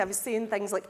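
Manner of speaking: ordinary talk, nothing marked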